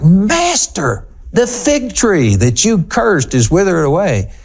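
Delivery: awestruck cry, pitched high; shocked amazement. Acted. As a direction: exclaim it as an amazed disciple. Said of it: "They were shocked. They were amazed".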